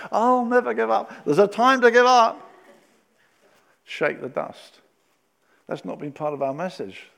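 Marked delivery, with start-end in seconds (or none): 0.1-1.1 mock stubborn cry. Imitating stubborn believers, louder. 1.2-2.5 loud insistence. Real, not acted. Preacher shouting the point.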